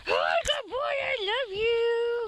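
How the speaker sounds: high, slow cooing to a dog; talking to a dog. Real, not acted. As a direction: coo lovingly at the pet.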